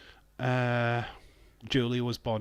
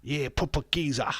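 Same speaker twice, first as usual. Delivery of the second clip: higher-pitched London geezer; exaggerated London geezer voice; mock London accent; 4 semitones higher than usual. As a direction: put on a broad London accent. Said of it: "It's more London".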